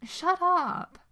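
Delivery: loud protest. A narrator's reading. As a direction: yelp "Shut up" in embarrassment. Sam protesting louder.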